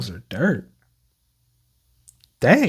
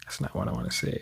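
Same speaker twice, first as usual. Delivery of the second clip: dismayed sigh; 6 semitones lower, 12 dB quieter, much faster than usual. Real, not acted.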